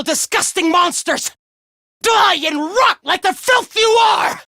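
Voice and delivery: high shouted tirade; enraged disgust. Acted. Villain vowing to kill the abominations, enraged shouting. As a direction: hurl each insult in a high, furious cry.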